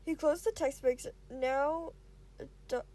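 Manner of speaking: plain reading voice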